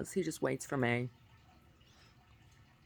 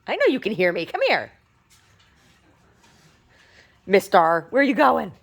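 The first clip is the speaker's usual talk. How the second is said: calling the dog loudly; 8 semitones higher, 11 dB louder than usual. Real, not acted. Mom calls after her dog.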